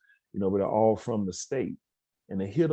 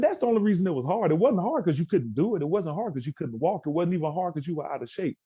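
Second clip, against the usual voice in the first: fired-up pep talk; 6 semitones higher than usual. Retold or imitated. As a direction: drive it home to the kids. Speaker lectures students, building force.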